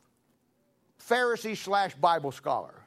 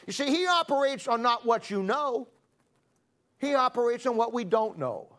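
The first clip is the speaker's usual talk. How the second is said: full-force preaching; 4 semitones higher than usual. Acted. Preacher rallying the saved congregation against the devil, full-force preaching. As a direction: drive it home to the congregation.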